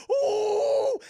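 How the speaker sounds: high, loud, drawn-out "ooh" of agony; imitated pained howl. Jax as Goro pulls his arms, mock pained howl. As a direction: howl as if your arms are pulled.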